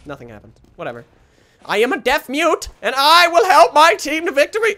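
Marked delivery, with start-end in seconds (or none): 1.6-4.7 loud, high declaration; rising, hammy hero speech; mock heroic proclamation. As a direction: declare it grandly to the team.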